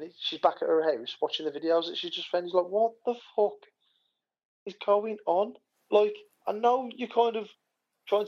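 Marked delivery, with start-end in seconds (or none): 2.7-5.5 high, incredulous quote; exasperated disbelief, voiced for a story; retold bafflement. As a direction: imitate someone baffled, pitched well up. Retelling a character's baffled reaction.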